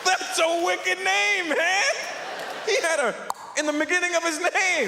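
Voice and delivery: high, drawn-out yell of amazement; excited yelling. Acted. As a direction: shout it high, thrilled by the name. Comic yells his mock amazement.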